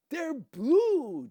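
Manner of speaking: high, loud excited announcement; eager blurt. Acted. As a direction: jump in before anyone else can. Dika butts in eagerly.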